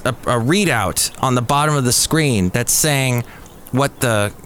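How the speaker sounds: ordinary talk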